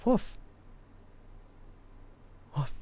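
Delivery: high, gentler woof; a lighter yap. Retold or imitated. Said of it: "does it a little softer than how I do it".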